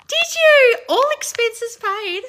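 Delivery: very high, loud, drawn-out disbelief; thrilled, incredulous shriek; astonished squeal. Real, not acted. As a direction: squeal the question in happy amazement. Astonished at fan's trip news.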